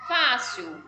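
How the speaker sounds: ordinary delivery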